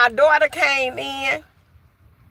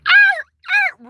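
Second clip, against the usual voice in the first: high, loud imitated dog yelp; imitating a dog crying out; 4 dB louder than usual. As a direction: yelp sharply like a hurt dog. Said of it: "real loud".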